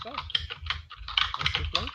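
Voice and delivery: slow, breathy, unvoiced purr; sultry whisper. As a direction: tempt them, slow and hushed. Madam greets the players.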